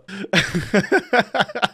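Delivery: helpless run of chuckles; real cackle at his own joke; laughing fit. Real, not acted. Streamer sorry for that one, cracking up laughing.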